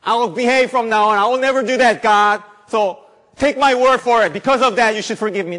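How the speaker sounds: high, loud whiny promise; mock promiser. Preacher mocking a sinner promising to behave, high and loud. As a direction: overplay a sinner's empty promise. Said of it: "Not according to my good behaviors".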